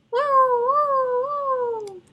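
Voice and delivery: high sustained woo like a siren; siren imitation. A narrator's reading. Boy pressing the siren button, wailing woo. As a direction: wail it like an ambulance siren.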